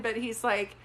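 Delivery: ordinary talk, nothing marked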